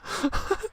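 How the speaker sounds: a quick, very high, soft unvoiced giggle; breathy giggle. Real, not acted. Softly giggling as the friend falls over. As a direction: let out a tiny squeak of a laugh. Laughing at character falling over.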